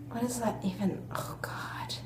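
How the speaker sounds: cringing whisper to herself. Real, not acted. Reading her old letter aloud, cringing whisper. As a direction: breathe it out under your breath, mortified.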